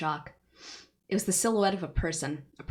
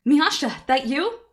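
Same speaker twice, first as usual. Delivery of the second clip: loud, much higher shout; yelled; 10 semitones higher, 11 dB louder than usual. Acted. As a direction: shout across the roof. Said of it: "I yelled".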